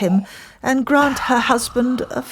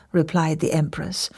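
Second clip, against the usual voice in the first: plain reading voice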